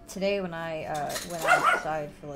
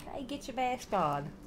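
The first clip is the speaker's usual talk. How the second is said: slow Brooklyn tough-guy voice; New Yorker telling you off, Brooklyn accent; 6 semitones higher, 4 dB quieter, slower than usual. Retold or imitated. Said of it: "he put on a good Brooklyn accent".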